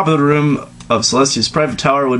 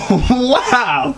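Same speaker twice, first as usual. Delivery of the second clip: shocked wow; 11 semitones higher than usual. Real, not acted. Shocked reaction while reading.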